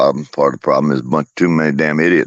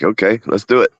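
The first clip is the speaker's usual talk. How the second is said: higher-pitched, playful imitation; eager challenger; 7 semitones higher than usual. Owner voicing his pet squaring up, playfully eager. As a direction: pitch it up, game for a scrap. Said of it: "See what he comes to me like".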